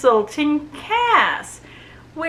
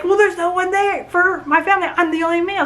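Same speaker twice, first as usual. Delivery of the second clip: indignant protest; 8 semitones higher, faster than usual. Retold or imitated. Acting Tobias protesting his replacement.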